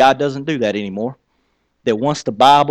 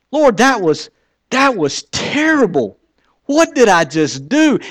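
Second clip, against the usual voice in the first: exasperated outcry; 8 semitones higher, slower than usual. Retold or imitated. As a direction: throw up your hands at it. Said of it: "I was like".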